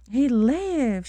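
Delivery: bursts out high and loud with joy; joyful shout. A narrator's reading. Maiden shouting joyfully to her bridegroom. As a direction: cry out that he lives. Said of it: "she shouted joyfully".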